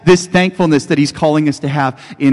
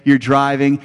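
usual speaking voice

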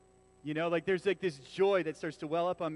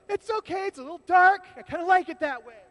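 forced chipper; 12 semitones higher, 10 dB louder than usual. Pretend. Burnt toast, fake joy.

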